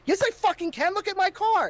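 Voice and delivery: retold angry yell. Retelling his fight with the liquor store clerk, a high indignant yell.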